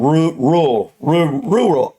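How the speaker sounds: halting attempts at "rural", tripping on the r sounds; struggling with "rural". Real, not acted. Retrying the word they can't say, stumbling on "rural". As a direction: break off at "ru-" and restart "rural". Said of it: "I can't even say the word".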